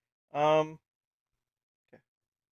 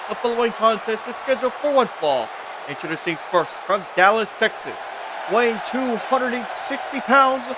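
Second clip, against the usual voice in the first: higher, louder, booming ring introduction; ring announcer; 6 semitones higher, 3 dB louder than usual. Acted. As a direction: announce the match to the arena.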